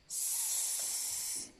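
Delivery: S as a long voiceless hiss, "sss"; letter sound. Shown as a demonstration. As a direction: hold a soft, steady hiss for S. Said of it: "Cette lettre-là chante". (S sifflé, « sss » prolongé)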